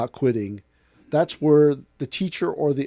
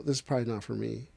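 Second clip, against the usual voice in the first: muttered defeat; 4 semitones lower, 9 dB quieter, faster than usual. Retold or imitated. Quitter voiced in story.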